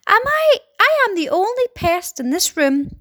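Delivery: high-pitched, boastful exclamation; gleeful cry. A narrator's reading. Centipede boasting to the room, crying out gleefully. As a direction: cry it out high and pleased with yourself. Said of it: "cried the centipede, still grinning away".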